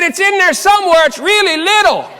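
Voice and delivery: high, loud mock holler back; retold calling back. Husband answers wife searching the shower for soap, hollered back.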